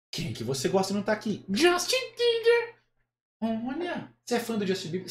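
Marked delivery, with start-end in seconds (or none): 1.5-2.7 very high, drawn-out puppet voice; Kleber. Acted. Puppet naming his idol, high puppet voice. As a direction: slowly announce the idol as a squeaky dummy. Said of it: "acha uma voz que pareça com o boneco".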